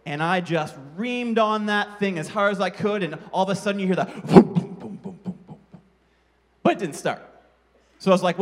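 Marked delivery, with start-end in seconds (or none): 4.5-5.5 engine sputter. Retold or imitated. Imitating engine failing to start.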